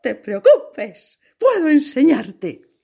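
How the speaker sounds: high, loud and slow offer to teach; a friendly dancer encouraging the scarecrow; kind dancer. Acted. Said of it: "el bailarín le contestó".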